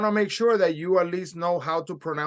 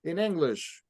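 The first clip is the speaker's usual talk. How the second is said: usual speaking voice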